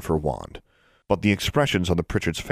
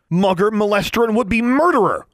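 louder, higher furious list; loud angry retort; 6 dB louder than usual. A narrator's reading. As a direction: spit each word back at him. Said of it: "Alexandra retorted".

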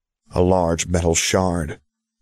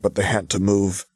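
ordinary delivery

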